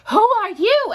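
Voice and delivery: high, loud surprised "who are you"; startled question. Acted. Sam startled by a stranger on his island, high and loud. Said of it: "asked Sam".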